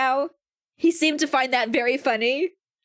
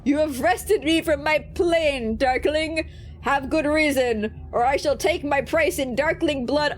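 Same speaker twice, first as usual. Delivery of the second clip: arrive grandly as a demon lord; booming demon; 2 semitones higher than usual. Acted. Demon summoned from its plane, booming and proud.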